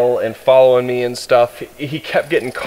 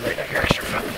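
whisper; 9 dB quieter, faster than usual. Real, not acted. Whispering to dog.

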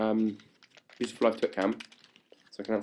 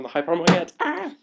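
higher and louder, bursting into a squeal; getting hyper; 8 semitones higher, 8 dB louder than usual. Real, not acted. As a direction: let the hyper energy explode. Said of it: "I'm a little bit hyper".

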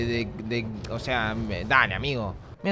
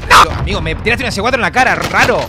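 outraged; 3 semitones higher, 8 dB louder than usual. Real, not acted. Hit by C4, outraged.

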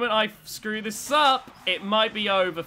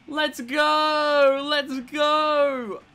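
loud, high, stretched cheer; victory shout; 7 semitones higher, 5 dB louder, much slower than usual. Real, not acted. Player after eliminating Endgame, victory shout. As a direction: shout it out in triumph.

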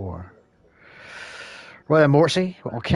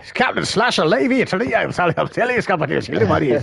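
hammed-up captain voice; 6 semitones higher, 4 dB louder than usual. Hammed-up character bit after Scottish.

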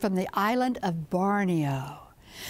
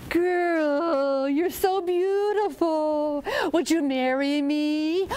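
high, slow crooning snake voice; lovesick snake; 5 semitones higher, much slower than usual. Acted. Snake proposes to the girl, high slow crooning. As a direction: woo her with long, drawn-out vowels.

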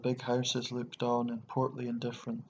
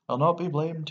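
raised, louder, heated protest; passionate cry; 5 semitones higher, 7 dB louder than usual. A narrator's reading. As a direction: cry it out in indignant denial. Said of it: "he cried, and his tubes were hoarse with passion".

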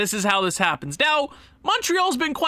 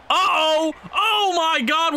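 alarmed; 7 semitones higher, slower than usual. Real, not acted. Close call in front of net.

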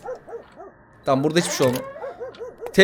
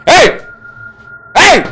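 yelling hey; 7 semitones higher, 4 dB louder than usual. Real, not acted. Yelling at game characters.

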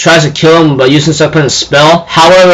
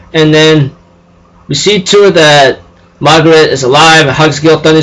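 usual speaking voice

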